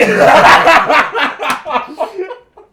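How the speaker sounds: roaring laugh; loud laughter. Real, not acted. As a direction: explode laughing. Huge laugh.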